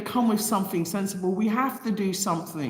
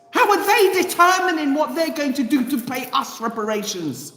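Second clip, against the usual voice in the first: raised, higher, louder demand; rally them with real fervor; impassioned outcry; 6 semitones higher, 3 dB louder than usual. Real, not acted. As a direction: passionate rising call to action.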